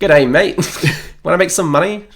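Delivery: mock Australian accent. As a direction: parody the Australian slip back at them. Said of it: "He's suddenly gone Australian".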